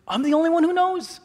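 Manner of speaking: very high comic disbelief; hammed-up shocked question; incredulous. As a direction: echo it back in squeaky astonishment. Teller reacts incredulously to secret.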